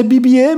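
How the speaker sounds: Bibier drawn out as French "bee-bee-EH"; French pronunciation. Shown as a demonstration. Defending mom's version as really French, Bibier as "bee-bee-EH". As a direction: say Bibier slowly, stressed on the end. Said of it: "o nome dele é francês".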